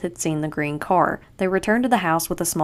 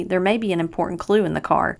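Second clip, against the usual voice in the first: ordinary delivery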